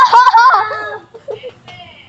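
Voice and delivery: loud burst of laughter; loud laughing shout. Real, not acted. Laughing at the pet.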